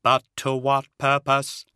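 hollow robotic questioning; hollow synthetic voice. Acted. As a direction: ask it like a machine, every word level. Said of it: "in his hollow synthetic voice".

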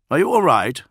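worried comrade checking in; concerned check. A narrator's reading. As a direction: ask it pointedly, high and loud. Solomon concerned at tense Julius.

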